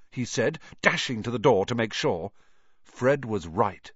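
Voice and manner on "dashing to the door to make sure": ordinary delivery, nothing marked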